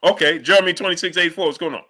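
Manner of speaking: ordinary talk, nothing marked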